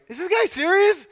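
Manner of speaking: high-pitched disbelief into a loud boom; mock incredulity. Thug offered the other cheek, mock incredulity. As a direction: play a thug who can't believe it.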